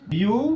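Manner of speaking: বিউটানেল begun with a long, rising first syllable; বিউটানেল stretched out as a model for students; sounding it out. Shown as a demonstration. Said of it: "উচ্চারণ কি হবো?".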